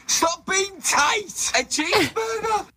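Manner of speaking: whiny plea. Acted. Begging for a takeaway.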